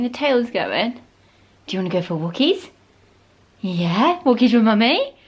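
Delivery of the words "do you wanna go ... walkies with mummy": dog voice. Real, not acted. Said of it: "Sorry about my dog voice".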